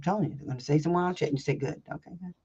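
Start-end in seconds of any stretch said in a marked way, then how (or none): 1.6-2.3 mock whispered agreement. Coach acts out brushing them off.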